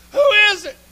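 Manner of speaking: high, loud shout up the cliff; desperate call. Acted. Dangling climber shouting up to the voice above. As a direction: call up hoping someone is there.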